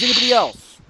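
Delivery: high, booming call to the room; loud demand. Acted. Captain turns to everyone else, shouting impatiently. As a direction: shout it out for anyone to answer.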